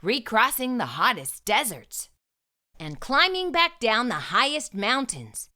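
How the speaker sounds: usual speaking voice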